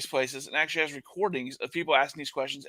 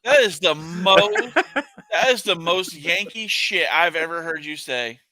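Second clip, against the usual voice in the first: slow, loud laughing outrage; 7 dB louder, slower than usual. Real, not acted. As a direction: laugh through mock indignation. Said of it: "laughing mock outrage".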